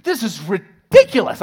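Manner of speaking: loud, high outraged yell; angry yell. Retold or imitated. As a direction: shout it out the window. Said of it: "I'm yelling at this one out the window".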